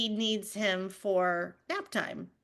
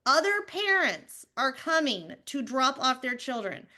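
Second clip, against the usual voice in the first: ordinary talk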